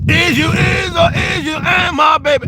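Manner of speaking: loud high hammed-up crooner voice; Louis impression. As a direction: belt it out like an old jazz crooner. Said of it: "I used to do his voice like".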